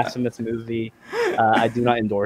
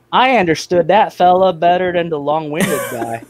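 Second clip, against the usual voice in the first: raised, twangy simpleton voice; simpleton twang; 6 semitones higher, 4 dB louder than usual. Acted. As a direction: put on a simple fella's twang. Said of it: "the twang that you went into".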